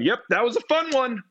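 high, over-eager agreement; awkward echo. Retold or imitated. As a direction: parrot it back in a high, goofy fan voice. Standing there awkwardly echoing the star.

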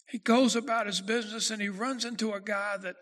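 ordinary talk, nothing marked

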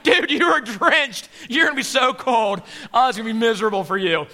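a loud jeer at the wet one; teasing disciples. Acted. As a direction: laugh at your soaked friend. Disciples teasing drenched Peter.

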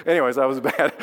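laughing at himself. Real, not acted. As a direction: chuckle through the words.

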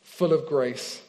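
slow, measured Bible reading with long pauses; slow Scripture reading. Real, not acted. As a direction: read it through slowly, phrase by phrase, letting each rest. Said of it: "I'm gonna read this through slowly".

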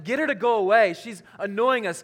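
annoyed disciples, played lightly; mild exasperated complaint. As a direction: lightly act out fed-up followers. Disciples' fed-up demand acted.

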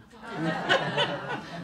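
high, loud burst of laughter; big laugh. Real, not acted. Medium laughing at her own story, high and loud. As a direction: let out a big delighted laugh.

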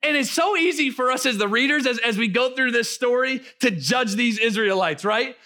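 fervent pastor preaching over music. Acted. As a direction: preach it to the church. Preaching at full force.

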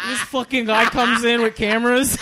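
annoyed cook. Retold or imitated. Angry cook ranting, laughing.

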